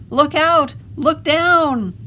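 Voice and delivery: high, loud alarm call; yelled warning. A narrator's reading. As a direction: shout an urgent warning. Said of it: "who yelled".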